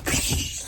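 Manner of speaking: voiceless burst of spraying liquid; imitated splash. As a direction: make a sudden splashing noise with the mouth. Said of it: "he got splashed in the face".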